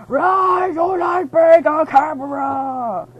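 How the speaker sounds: hammed-up heroic shout to the camera; dramatic shout. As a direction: proclaim it like a movie hero.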